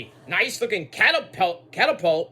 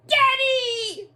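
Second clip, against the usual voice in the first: put-on girlish daddy call. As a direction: squeal for Daddy like a spoiled daughter. Voicing Tiffy calling her father.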